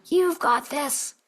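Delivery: high, slow little squirrel voice; cheering squirrel. A narrator's reading. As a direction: lift it up high like a tiny cheering critter. Squirrel seems to cheer her on.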